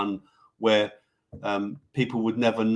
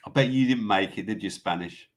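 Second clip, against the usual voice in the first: ordinary talk, nothing marked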